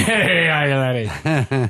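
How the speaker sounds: drawn-out, slow Irish-leaning brogue; mock Irish lilt. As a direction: play up an Irish-sounding "aye, laddie". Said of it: "that was more Irish, but, uh, whatever".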